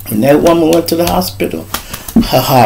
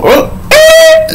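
high startled cry; startled yelp. Retold or imitated. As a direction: yelp as if caught off guard. Girlfriend startled by question.

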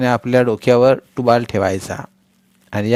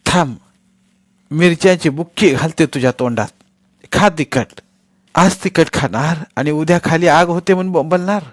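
louder, higher furious scolding; angry scolding; 7 semitones higher, 5 dB louder than usual. A narrator's reading. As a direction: threaten the old woman in anger. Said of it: "राधी संतापानं म्हणाली".

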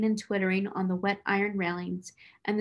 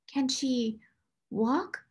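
breathless; 4 semitones higher, much slower than usual. A narrator's reading. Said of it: "she asked breathlessly".

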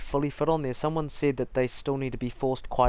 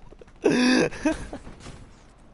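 loud squealing laugh; high excited laugh; 7 dB louder than usual. Real, not acted. Laughing after the shout.

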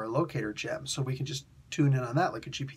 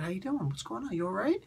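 higher and softer, coaxing; gentle, soft concern; 6 semitones higher, 5 dB quieter, faster than usual. Retold or imitated. Puck softly checks on a shaken Marina, high and gentle. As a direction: ease up on her softly like a worried friend.

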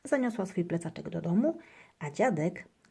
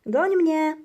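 high, loud chase-me call; girl calling her friend; playful call; 10 semitones higher, 9 dB louder than usual. A narrator's reading. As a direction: call out to play. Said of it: "woła do swojego przyjaciela".

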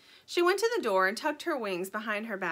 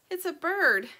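little bird voice; 8 semitones higher, 5 dB louder than usual. Acted.

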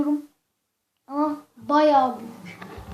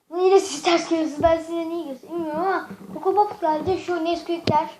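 ordinary delivery

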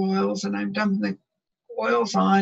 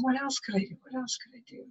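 quiet wondering; 3 semitones higher, 11 dB quieter, faster than usual. Retold or imitated. Looking around, asking herself.

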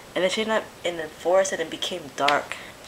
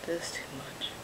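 a hushed, disbelieving whisper; shocked whisper; 6 semitones lower, 18 dB quieter than usual. Real, not acted. Viewer stunned by the video, whispering in shock.

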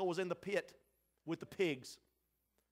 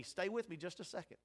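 normal talking voice

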